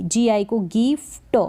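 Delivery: normal talking voice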